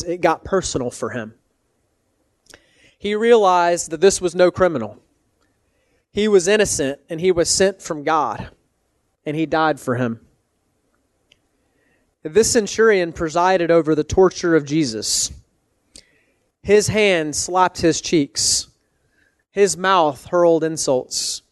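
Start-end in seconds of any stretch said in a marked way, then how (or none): none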